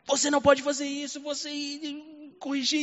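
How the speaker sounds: light imitation of a pleading daughter; child's plea. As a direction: plead like a frightened little girl.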